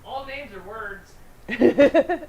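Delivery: distant yell from the next room; shouted from far off; from another room. Real, not acted. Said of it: "are you yelling at me from the other room".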